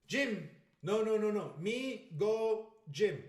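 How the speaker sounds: loud, high broken English, word by word; fake broken English. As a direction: play dumb, pretending to speak no Spanish. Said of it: "I pretended I didn't know a word of Spanish".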